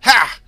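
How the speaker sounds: loud high bark of scorn; old Creole woman scoffing at landlord. Acted. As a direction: laugh him off sharply. Angelique scoffs.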